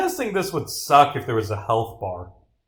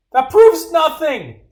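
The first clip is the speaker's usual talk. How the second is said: loud, very high, drawn-out protest; outraged denial; 8 dB louder, slower than usual. Acted. Witness rejects detective's evidence, outraged cry.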